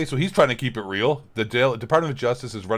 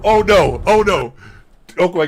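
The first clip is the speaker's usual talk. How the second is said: loud, high groan of dread; loud dismay; 6 semitones higher, 10 dB louder than usual. Real, not acted. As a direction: cry out in mock despair. Reacting to Alex Jones clip.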